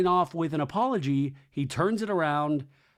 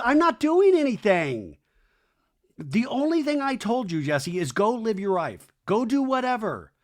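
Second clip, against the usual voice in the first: loud, high mock protest; high-pitched retold quarrel voice; indignant retort; 7 semitones higher, 4 dB louder than usual. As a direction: act out an exasperated ex snapping back. Retold quarrel, Michelle protesting to Jesse.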